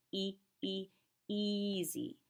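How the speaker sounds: "E" held as a long ee; long E sound. Shown as a demonstration. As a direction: stretch "easy" with a long ee. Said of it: "the long E that says easy".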